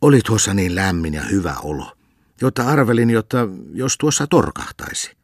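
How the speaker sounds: put-on old man explains sleepily to the cantor; sleepy old man. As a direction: rub your eyes and excuse yourself. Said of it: "Unta silmistänsä hieroissaan hän puhelikin".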